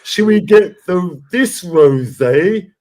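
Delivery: slow, high, dopey shopper voice; mocking slow shoppers in the supermarket aisle, high and drawn out; mock dithering shopper. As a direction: drag it out like a clueless shopper blocking the aisle.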